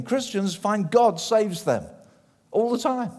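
ordinary talk, nothing marked

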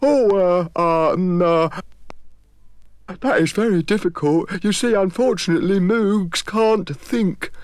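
a simple mind straining; hesitant Moog. Acted. Moog struggling to think.

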